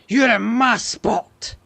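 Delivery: loud, slow mock Scottish accent. Gamer taunts the car in his spot, hammed-up Scottish accent. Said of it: "went all Scottish".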